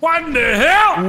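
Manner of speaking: high, loud incredulous yell; astonished. Real, not acted. Player reacting to a tiny lobby, astonished. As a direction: blurt it out in loud disbelief.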